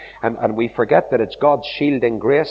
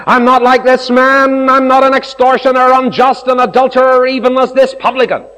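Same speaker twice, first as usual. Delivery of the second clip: proud Pharisee; 10 semitones higher, 7 dB louder than usual. Retold or imitated. Pharisee boasting to God, loud and self-satisfied. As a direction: puff yourself up before God.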